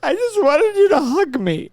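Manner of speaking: whiny high mock wife; mock hurt wife. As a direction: play the wife begging for a hug. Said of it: "she's like".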